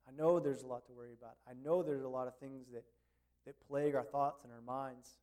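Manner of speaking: soft, hushed pastoral prayer. Real, not acted. Pastor prays over the congregation, soft and hushed. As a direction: pray quietly for the people to be present.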